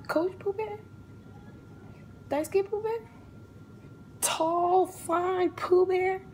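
high, rising incredulous questions; stunned disbelief. Retold or imitated. To her friend, realizing it's her man, disbelief.

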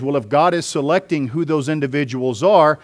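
ordinary talk

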